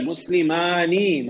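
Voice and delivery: مسلمانی spelled out slowly: مس-لِ-ما-نی with a long final ی; slow classroom demo; slow drawn model word. Shown as a demonstration. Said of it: "teacher models the dual form".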